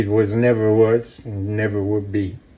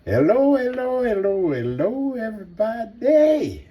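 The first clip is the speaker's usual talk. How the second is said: high bouncy hellos; bright repeated hellos, higher; sing-song greeting; 11 semitones higher than usual. Real, not acted. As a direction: greet the crowd like a cheery host.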